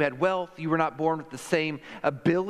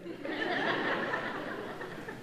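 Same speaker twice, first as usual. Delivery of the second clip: soft, high-pitched laugh; quiet chuckle trailing away; real laugh; 13 semitones higher, 8 dB quieter than usual. Real, not acted. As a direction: laugh softly after your own quip. Laughs after Mother's Day quip.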